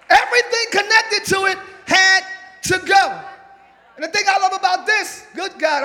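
loud, driving preaching; preaching at full force. Acted. Preacher declaring to the congregation what must go, at full force. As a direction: shout it to the congregation.

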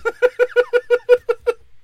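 explosive high laughter; laughing hard at the Hitler joke; loud high laugh. Real, not acted. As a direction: howl with laughter.

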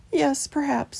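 high, understated sadness; mildly tearful mouse. A narrator's reading. Christopher wiping away a tear, high and mildly sad. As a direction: agree sadly in a high voice. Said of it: "wiping away a tear".

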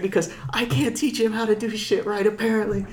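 high, strained mock yelling, kept down in volume; held-back mock tirade; retold yell. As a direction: imitate an instructor chewing out the group. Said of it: "heard that lady yell so loud".

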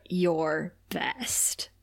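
breathy unvoiced whisper; a whispered mentor's verdict. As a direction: hush it like a stern last word. Giles quietly pushing Buffy away.

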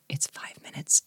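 hushed whisper. Real, not acted. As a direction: whisper it like a secret. Sharing a secret tip.

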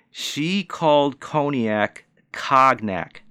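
"cognac cognac" said slowly, second as "kahg-nak"; mock sounding out. Ranting about the new bar manager, mock child-like sounding out. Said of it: "sounded it out like a five-year-old would".

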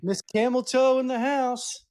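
slow, high hype-man call; announcer hype. Acted. Host hyping Eva Marie's entrance like an announcer. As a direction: call her in like a ring announcer.

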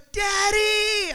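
put-on high, loud child's cry of Daddy; excited child. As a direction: shout it like a kid thrilled to see dad. Son greeting dad at door.

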